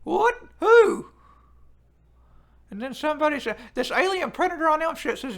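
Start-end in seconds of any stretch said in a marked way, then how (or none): 0.0-1.1 surprised. Real, not acted. Chat claims a Rock mix-up.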